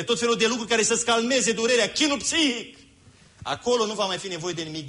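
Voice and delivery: high, soaring preacher's voice lifted well above his talk; fervent preaching. Acted. Preacher promising the faithful an end to sorrow, fervent and soaring. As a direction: rise up and proclaim it to the congregation.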